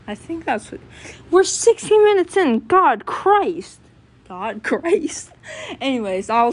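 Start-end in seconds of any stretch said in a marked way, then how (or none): 1.3-3.8 high, loud startled outburst; shocked at the time. Real, not acted. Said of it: "shocked at the time". 4.3-5.3 laughing. Real, not acted. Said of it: "laughs at the time".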